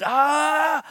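mournful scream; anguished scream. Acted. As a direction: voice leaps up into a loud, drawn-out cry of despair. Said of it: "you're just screaming?".

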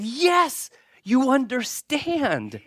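high, loud eager yes, then a slow you understand; mock-enthusiastic reply; eager agreement. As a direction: play the delighted listeners who think they have been understood. Acted eager agreement of Hindus.

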